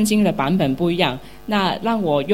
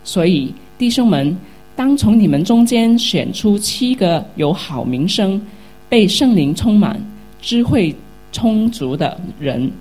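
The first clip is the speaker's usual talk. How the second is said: louder, measured Bible reading voice; scripture reading; 4 dB louder than usual. Acted. Pastor reading Acts chapter six aloud to the congregation, loud solemn reading. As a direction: proclaim the verses to the whole church.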